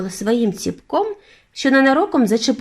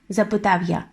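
usual speaking voice

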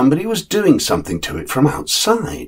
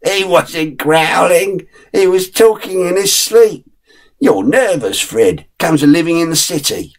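lightly voiced father, higher and amused; the father teasing his nervous son, a touch higher; father's voice; 6 semitones higher than usual. Father chaffing Fred about city nerves, lightly voiced.

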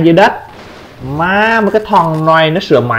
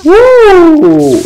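long, high-pitched "woo"; high whoop; 4 dB louder than usual. Real, not acted. Tiếng hú khi đang chơi game. (tiếng hú cao, kéo dài)

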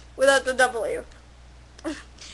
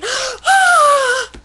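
high, loud hammed-up gasp; loud dramatic gasp; 9 semitones higher, 4 dB louder than usual. Vampires gasp at her song, loud mock gasp. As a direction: gasp like a shocked crowd. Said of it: "Everyone gasped".

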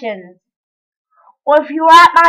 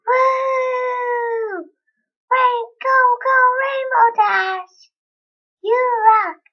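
high mock cheer; 8 semitones higher, slower than usual. Kid cheering Rainbow Dash, high-pitched.

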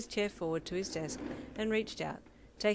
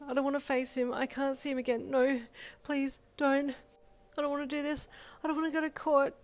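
hyperventilating, babbling panic; panicked rambling; 6 semitones higher than usual. Acted. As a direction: start small and breathy, spiral up into panic. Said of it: "Your mind rambled and babbled".

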